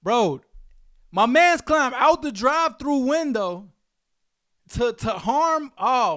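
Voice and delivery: loud, high-pitched disbelief; incredulous outburst. Real, not acted. Host reacting to a shocking news clip, incredulous outburst.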